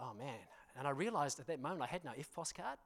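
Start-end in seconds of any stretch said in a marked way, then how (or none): 0.0-0.4 hushed dismay. Retold or imitated. Retold dismayed inner thought.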